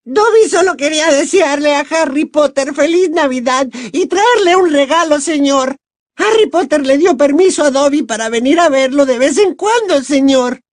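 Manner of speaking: very high squeaky elf voice; nervous, eager elf. A narrator's reading. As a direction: squeak it out like a deferential little elf. (voz de elfo chillona y muy aguda)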